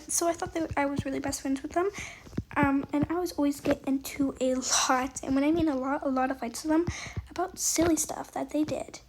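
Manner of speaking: ordinary talk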